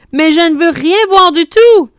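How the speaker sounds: high, loud cry of refusal; a child shouting back at his mother, loud and high; child's protest shout. A narrator's reading. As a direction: wail it like a scared boy refusing.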